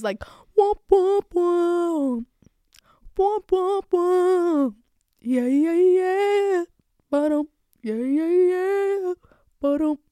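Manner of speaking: loud high hype ad libs; rap ad libs. Shown as a demonstration. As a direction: chant the ad libs like a rapper. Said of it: "I love ad libs".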